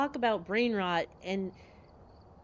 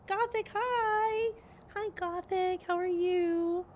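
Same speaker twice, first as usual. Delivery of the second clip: much higher, slow drawn hello; sing-song greeting; 11 semitones higher, slower than usual. Real, not acted. Streamer greeting Gothic in chat, high sing-song. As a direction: croon a welcome.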